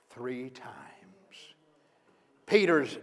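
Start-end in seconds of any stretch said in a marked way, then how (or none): 0.1-1.4 whisper. Acted. Jesus' prophecy recalled.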